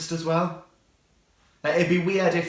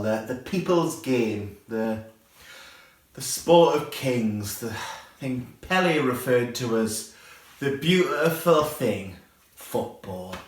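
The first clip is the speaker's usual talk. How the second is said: slow grand build-up; 3 semitones lower, slower than usual. Shown as a demonstration. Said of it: "grand build-up to football".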